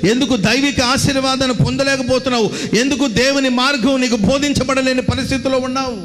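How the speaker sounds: high, insistent rebuking questions; exhorting preacher. Acted. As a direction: press the congregation with question after question. Preacher challenges the congregation. (ఎత్తైన స్వరంతో నిలదీసే ప్రసంగం)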